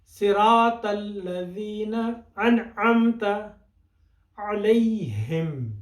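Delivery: each word drawn out slowly, علیہم stretched long; slow careful recitation. Shown as a demonstration. Teacher slowly models the verse for students. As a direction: recite صراط الذین انعمت علیہم slowly and clearly. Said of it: "آرام سے".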